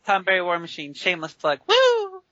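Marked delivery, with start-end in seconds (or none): 1.7-2.2 excited whoop. Real, not acted. Celebrating the shameless plug.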